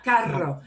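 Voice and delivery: "Carro" with a firmly rolled R; trilled R. Shown as a demonstration. Teacher repeating car for students, "Carro" with a strong trilled R. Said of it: "if you trill the R a little less".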